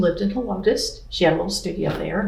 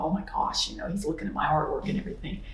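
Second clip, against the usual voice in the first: breathless, fast, hushed gossip; gushing hushed whisper; 9 dB quieter, faster than usual. Retold or imitated. Ladies at the art show whisper about the famous artist, hushed and fast. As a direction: whisper it quickly like excited ladies at a booth.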